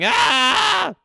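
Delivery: high, loud playful yell of a chat message; mock shout. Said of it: "Internet Crusader going".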